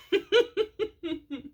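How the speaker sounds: high delighted laugh. Real, not acted. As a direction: let out a bright laugh. Laughing at the gentleman.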